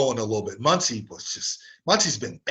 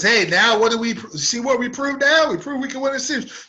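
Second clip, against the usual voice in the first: higher, smug bragging mock voice; mocking idiot player; 9 semitones higher, 3 dB louder than usual. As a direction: mimic a cocky player showing off. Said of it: "This idiot comes on and says".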